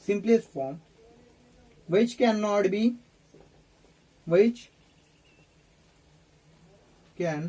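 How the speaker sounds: slow chunks with long gaps; dictation. Real, not acted. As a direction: dictate slowly, repeating for note-takers. Dictates while writing on board. (धीरे-धीरे, टुकड़ों में लिखवाते हुए)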